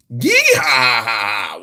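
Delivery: villain's rowdy whoop; cowboy whoop. Acted. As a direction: holler it like a rodeo rider. Reading Summers' cry.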